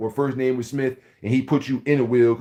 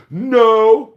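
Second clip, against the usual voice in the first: put-on high, loud kid reply; child's answer; 9 semitones higher, 9 dB louder than usual. Scolded child answering his parent, high and loud.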